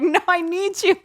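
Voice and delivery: high-pitched, hammed-up desperate plea; mock pleading. Woman begging her departing soul to stay, mock-desperate plea. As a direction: plead high, as if being abandoned.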